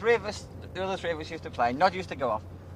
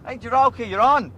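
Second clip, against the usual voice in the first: a louder urgent call to the bar; 5 semitones higher, 8 dB louder than usual. Retold or imitated. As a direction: hurry the dancer up with a shout. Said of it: "we go up to Jiroki".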